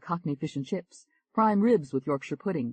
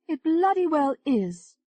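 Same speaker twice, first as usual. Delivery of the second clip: higher, lively English woman; higher bubbly chat voice; [name], flirty; 13 semitones higher, 5 dB louder, slower than usual.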